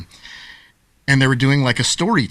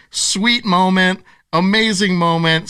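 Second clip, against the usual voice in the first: usual speaking voice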